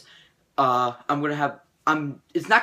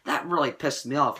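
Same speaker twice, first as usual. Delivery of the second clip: ordinary talk, nothing marked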